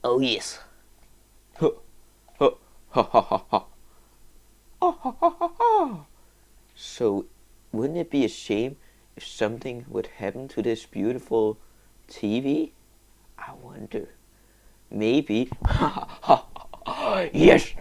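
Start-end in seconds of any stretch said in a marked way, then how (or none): 4.8-6.1 high, hammed-up villain laugh; mock evil laugh. Gamer doing a high villain laugh at his plan. 12.1-14.1 sneaky whispered wondering; sly whisper. Pretend. Gamer whispering slyly before wrecking the TV.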